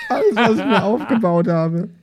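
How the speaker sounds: high, laughing wail over a ruined roll; laughing despair. Real, not acted. Player laughs and wails after teammate's bad roll. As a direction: shriek with laughter at the loss.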